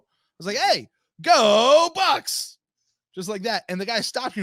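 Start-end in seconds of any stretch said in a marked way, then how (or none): 0.6-2.6 long, high, much louder drawn-out cheer; stretched stadium-style call of go Bucks; sign-off catchphrase yell. Retold or imitated. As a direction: belt it out like the show's sign-off. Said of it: "the way I do it at the end of the show".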